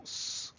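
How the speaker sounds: S as a held hiss, not the letter name; isolated consonant sound. Shown as a demonstration. Teacher isolating the first sound in puissance, S as a held hiss. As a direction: sound out S as a sustained "sss". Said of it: "au premier son S".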